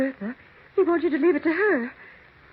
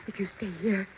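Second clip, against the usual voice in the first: much softer, lower dread-filled warning; ominous hushed warning; 5 semitones lower, 11 dB quieter than usual. Acted. Mary warning Elizabeth to flee the house, hushed and fearful. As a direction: drop to a frightened near-murmur.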